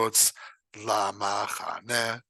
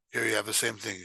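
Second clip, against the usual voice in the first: normal talking voice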